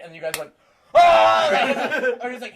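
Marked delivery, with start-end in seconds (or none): 0.9-1.5 high, loud mock scream; sudden yell breaking into laughter; wall-of-noise scream. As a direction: imitate a group erupting on the phone. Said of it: "this wall of noise".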